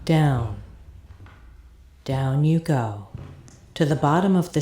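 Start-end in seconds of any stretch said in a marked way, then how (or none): 0.0-3.1 descending, lower and lower; sinking lower. Acted. Descending the stairs.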